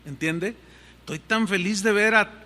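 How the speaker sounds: normal talking voice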